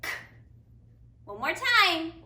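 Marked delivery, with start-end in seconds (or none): 0.0-0.1 "K" as a bare voiceless click of the k consonant; unvoiced k sound. Shown as a demonstration. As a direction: say only the sound of "K", no vowel and no voice. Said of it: "is an unvoiced sound".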